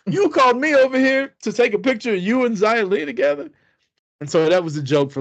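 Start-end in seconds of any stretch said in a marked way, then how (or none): 0.1-3.5 high, incredulous protest, laughing through it; mock outrage. Telling Angel he was only the cameraman, high incredulous protest. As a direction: act stunned you were just the photographer.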